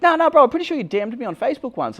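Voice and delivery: high, loud eager bloke; insistent fan voice. Retold or imitated. The fan insists he watches the channel, high and loud. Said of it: "He's like".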